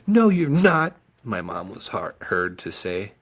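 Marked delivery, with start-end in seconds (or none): 0.1-1.0 high, loud protest; protesting woman. Acted. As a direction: refuse firmly in a high voice. Mom protesting.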